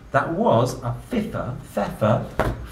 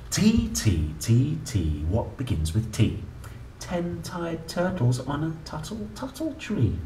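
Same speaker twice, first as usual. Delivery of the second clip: lively sing-song picture-book reading; reading to children; 4 semitones higher, 4 dB quieter than usual. Acted. Parent reading an ABC book to a child, playful sing-song. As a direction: read it out like a bedtime book for a small child.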